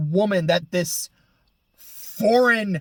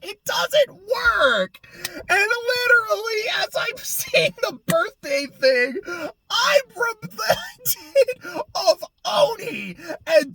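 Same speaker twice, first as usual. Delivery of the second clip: much higher, slow, near-crying yell; outraged yelling; 12 semitones higher, slower than usual. Real, not acted. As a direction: shriek your frustration, almost in tears. Ranting, half laughing, half crying.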